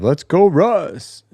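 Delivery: loud drawn-out cheer, high. Real, not acted. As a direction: shout it out for Russ. Cheering for a player.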